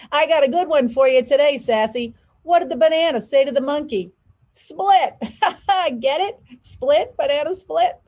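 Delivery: put-on a grinning old uncle rushing to his punchline; jolly joking uncle. Uncle Red telling his joke.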